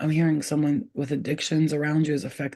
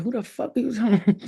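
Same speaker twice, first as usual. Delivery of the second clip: retold indignant comeback, pitched up; mad retort; 6 semitones higher than usual. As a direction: snap back at an unseen heckler, mildly mad. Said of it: "Instead of getting mad".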